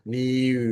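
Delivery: "niu" drawn out with lips rounded on the vowel; rounded mouth. Shown as a demonstration. Teacher modelling for the student, slow "niu" with a round mouth. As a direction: stretch "niu", keeping the i sound rounded. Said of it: "Mulutnya bulat ya".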